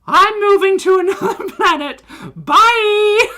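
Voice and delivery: hammed-up high, loud cry; melodramatic exit. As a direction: storm off theatrically, breaking into laughter. Hammed-up dramatic exit from the message.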